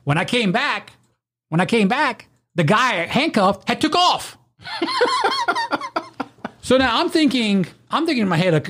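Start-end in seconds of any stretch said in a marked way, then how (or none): 4.6-6.2 a long, high burst of laughter. Real, not acted. Laughing high when the handcuffed guy ran off. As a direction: let a high laugh escape.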